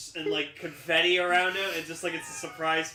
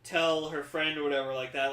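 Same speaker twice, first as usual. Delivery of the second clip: usual speaking voice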